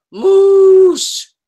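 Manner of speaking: high, loud bellowing moose call. Pretend. Introducing her moose pen to the class, a loud high moose call. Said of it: "That's what they sound like".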